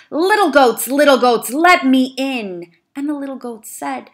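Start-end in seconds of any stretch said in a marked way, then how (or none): 0.1-2.8 loud, higher, slow growl; hungry wolf growling through the door. Acted. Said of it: "The wolf growled".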